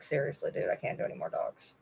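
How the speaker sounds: a hushed confession to yourself; whispered aside. Real, not acted. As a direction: whisper it under your breath. Muttered aside to herself.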